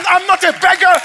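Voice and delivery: high, loud declaration; shouted preaching. Acted. Pastor declaring to the church he is no beggar, shouted. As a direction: fire it out at the crowd.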